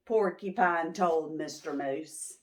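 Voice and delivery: usual speaking voice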